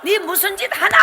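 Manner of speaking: mother screams at her daughter teaching; mother screaming. Retold or imitated. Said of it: "이렇게 소리 질렀다는 거 아닙니까".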